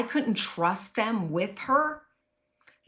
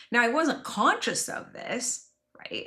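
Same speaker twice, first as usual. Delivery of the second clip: ordinary talk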